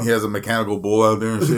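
ordinary delivery

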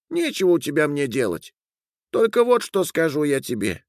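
high, thin little voice; Shignamanush. Acted. Little being refusing to stay with the man, thin and stern. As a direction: pronounce the verdict in a tiny high voice.

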